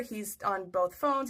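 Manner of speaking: ordinary talk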